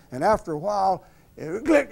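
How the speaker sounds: ordinary delivery, nothing marked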